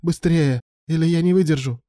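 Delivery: high, pressing plea; urgent begging. Acted. Alan urges his lover on, high and urgent. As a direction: beg as if time runs out.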